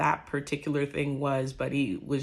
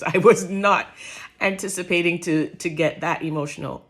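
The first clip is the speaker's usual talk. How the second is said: getting emotional; 7 dB louder than usual. Real, not acted. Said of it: "I'm getting a little emotional".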